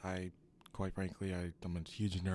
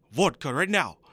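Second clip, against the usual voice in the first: loud Russian accent, barking the demand; shouted Russian accent; 6 semitones higher, 12 dB louder than usual. Shown as a demonstration. Showing off a Russian accent, loud demanding Russian accent. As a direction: yell for vodka in a Russian accent. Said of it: "How does that sound for Russian?".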